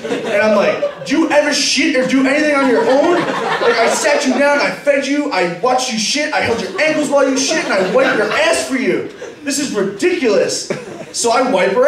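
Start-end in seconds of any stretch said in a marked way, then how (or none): 1.1-10.7 higher-pitched, fed-up scolding; exasperated rant. Acted. Babysitter complains to the girl about helping, exasperated. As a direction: list the grievances in comic outrage.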